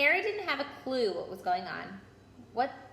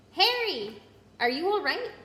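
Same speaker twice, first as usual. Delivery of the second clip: a loud, high worried call; 8 semitones higher, 6 dB louder than usual. A narrator's reading. Hermione calling to Harry as she runs toward him. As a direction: shout to him from down the path.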